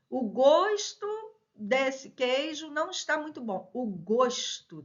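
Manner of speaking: "gosto" with a closed O, "GÔS-to". Shown as a demonstration. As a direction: round the O of "gosto" into ô.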